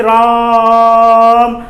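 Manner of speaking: "राम" with the आ held very long, calling from afar; pluta long vowel. Shown as a demonstration. Teacher calling out "राम" from a distance, vowel held long. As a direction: stretch the आ in "राम" as if calling someone far away. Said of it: "किसी को आवाज दे रहे हैं".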